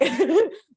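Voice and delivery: quick high laugh about the dogs; real amused laugh. Real, not acted. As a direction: laugh it off brightly.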